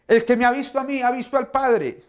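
a preacher mock-scolding the disciples, impatient. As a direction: scold them as if they should know better. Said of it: "Yo no me imagino yo respondiéndoles de esta manera".

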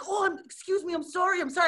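high-pitched mimicked apology; retold flustered apology. Retold flustered apology after bump.

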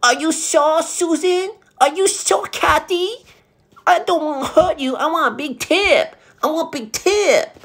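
high falsetto fake concern; shrill-high mock customer. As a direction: squeak out a fake-sweet worry.

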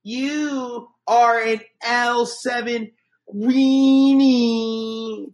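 put-on high, slow taunting kid voice; Sandlot taunt. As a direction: drag out the schoolyard insult. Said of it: "You can't resist The Sandlot".

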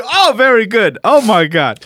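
high, loud mock approval; imitating an overjoyed man, high-pitched; delighted club owner. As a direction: gush like a hammed-up club owner. Mimics delighted club owner's reaction.

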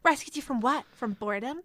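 sharp snap, high voice; snapping. A narrator's reading. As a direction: snap it back scornfully. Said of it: "Renee snapped".

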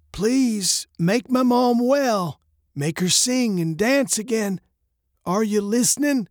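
slow, high-pitched plea from a note; reading a note aloud. Acted. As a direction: read the handwritten prayer high and drawn out.

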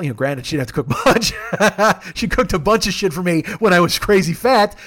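chuckling through the words; amused, laughing aside. Real, not acted. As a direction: laugh as you say it. Laughing while recalling Karen.

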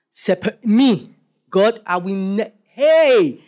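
fervent vow. Retold or imitated. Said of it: "how many of you remember that then you felt that".

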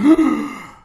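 loud, high mock gasp; big sharp intake of astonishment; shocked gasp. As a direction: gasp in amazement, acting it out. Retold shock at finding the man.

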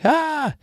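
sudden high yelp of fright, hammed-up; mock terrified cry. Disciples seeing a ghost on the sea, mock terrified yelp. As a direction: shriek like you just saw a ghost, half-joking. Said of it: "That sounded pretty good".

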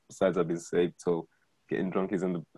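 ordinary delivery

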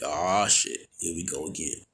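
weary, sighing death-row inmate; resigned inmate voice. Retold or imitated. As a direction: groan it like it's routine. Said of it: "and you're just like".

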